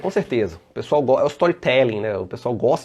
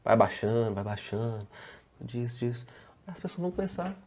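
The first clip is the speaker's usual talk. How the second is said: voice dropping; 9 dB quieter than usual. Shown as a demonstration. Trainer demonstrating a change of tone to grab attention, growing very soft. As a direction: fade steadily down to a near whisper. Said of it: "muda o tom de voz um pouquinho".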